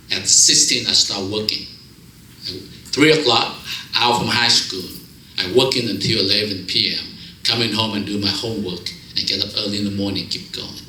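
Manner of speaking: usual speaking voice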